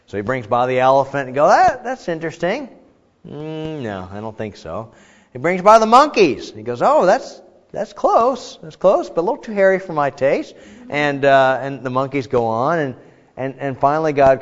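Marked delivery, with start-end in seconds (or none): 1.5-2.7 high-pitched, curious Adam reaction; intrigued. Acted. Voices Adam viewing the elephant. 3.3-4.9 soft, low mock deliberation; doubtful refusal. Adam turns down the elephant. 6.8-10.6 high-pitched, playful Adam reaction; mock near-approval.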